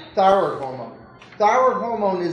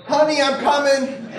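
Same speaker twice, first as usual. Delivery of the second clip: higher, louder hammed-up call to his wife; mock husband calling; 7 semitones higher, 5 dB louder than usual. Lecturer voices the patient calling out to his waiting wife, hammed-up.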